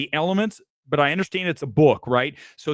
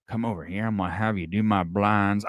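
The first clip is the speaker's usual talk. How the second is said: Southern rich-guy accent; 5 semitones lower than usual. Shown as a demonstration. Critic imitating an actor's performance, Southern rich-guy accent. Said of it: "see if I can do the accent".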